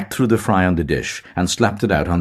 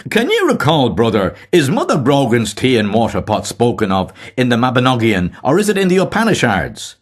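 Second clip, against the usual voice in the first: 1 fine puzzled voice; 7 semitones higher, 5 dB louder than usual. A narrator's reading. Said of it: "in a fine, puzzled voice".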